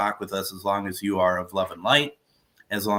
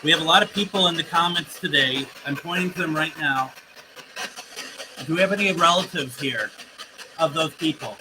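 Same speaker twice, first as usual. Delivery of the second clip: raised, higher voice calling out to unseen spirits; 7 semitones higher than usual. Acted. Medium addressing spirits through the device, raised and higher. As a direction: project up and out into the empty room, inviting.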